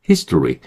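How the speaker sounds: "history" as HIS-dri, weak middle vowel; reduced history. Shown as a demonstration. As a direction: say "history" with tense t, front-stressed. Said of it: "이 정도 하면 되고요".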